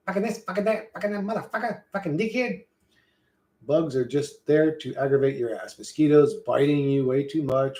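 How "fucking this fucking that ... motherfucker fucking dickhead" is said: higher, softer mimicry of sweary talk; mock constant swearer. Imitating people whose every second word is a swear, mock swearer. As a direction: rattle off curses as an impression, pitched up. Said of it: "every second word is fucking".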